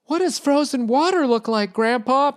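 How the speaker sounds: put-on high, wide-eyed little kid asking; a puzzled child who has never seen ice; curious grandkid. Grandkid asking grandpa about frozen water, high curious child voice.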